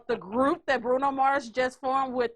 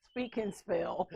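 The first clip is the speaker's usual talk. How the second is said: breathless giggling whisper; laughing whisper; 5 semitones lower, 8 dB quieter, slower than usual. Real, not acted.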